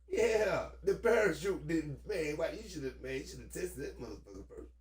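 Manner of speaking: ordinary talk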